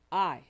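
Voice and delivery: "I" alone, loud and emphatic; stress on I. Shown as a demonstration. Speaker starts the affirmation for the listeners, stressing "I" loudly. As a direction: hit "I" hard as a word on its own. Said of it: "with emphasis on specific words".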